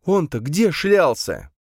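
high, slow indignant question; angry exclamation. A narrator's reading. Camille asking where the watchman was, angry exclamation. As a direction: demand it crossly, dragging it out high. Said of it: "сердито воскликнула Камила".

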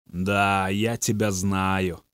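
low, soft and slow, empty-eyed; lightly voiced possessed boy, hollow and slow; hollow reply. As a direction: answer from far away, barely there. Possessed Bobby answers dully.

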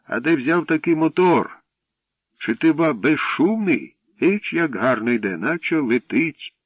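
high, loud admiring call. A narrator's reading. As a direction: call over in lively amazement. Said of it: "крикнув дідок".

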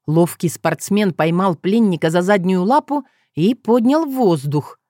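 usual speaking voice

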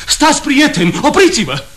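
high, loud commanding cry; loud shout. Retold or imitated. Benvolio retelling Romeo calling the friends to stop, shouting. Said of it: "Romeo strigă tare".

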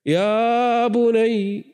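high, very slow pleading call; father calling out. Acted. Noah calling his drowning son, drawn-out plea. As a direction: stretch it out, calling across the water.

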